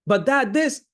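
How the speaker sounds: high, loud interjection; acted-out kid pleading to be heard; excited protesting son. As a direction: burst in eagerly on Dad. Son interrupts dad, still excited.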